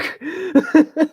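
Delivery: squeaky burst of laughter; high-pitched laugh. Real, not acted. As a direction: laugh up high, caught off guard.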